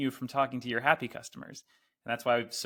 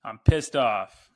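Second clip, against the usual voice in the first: slow, loud pissed off; mock angry greeting to the host; quoting the angry customer; 11 dB louder, much slower than usual. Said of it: "Is that a reference from".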